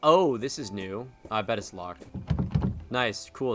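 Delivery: ordinary talk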